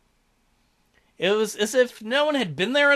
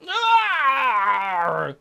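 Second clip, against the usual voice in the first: pained roar. Acted. Narrated pain bursting, cries out.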